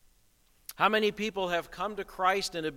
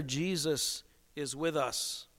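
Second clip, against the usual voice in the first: usual speaking voice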